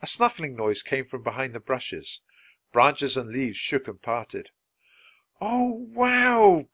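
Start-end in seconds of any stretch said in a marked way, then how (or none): 5.4-6.7 breathed. A narrator's reading. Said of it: "breathed Annie".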